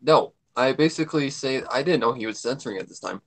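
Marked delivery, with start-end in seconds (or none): none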